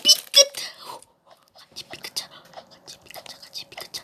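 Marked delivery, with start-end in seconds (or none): 1.5-4.0 whispering. Pretend. Repeats the phrase under breath.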